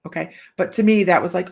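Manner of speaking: usual speaking voice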